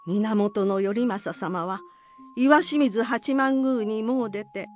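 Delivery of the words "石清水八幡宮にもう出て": ordinary delivery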